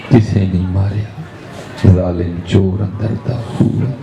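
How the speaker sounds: slow, measured verse recitation; poetry recital. Acted. Preacher recites a Punjabi verse to the congregation, slow and measured. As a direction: draw out each line of the Sufi couplet.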